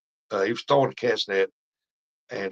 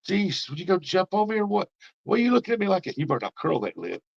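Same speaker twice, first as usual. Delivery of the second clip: much higher, playful fussing at the dog; mock scolding the dog; 10 semitones higher than usual. Real, not acted. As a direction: tease the dog for jumping up. Scolding the dog jumping on him.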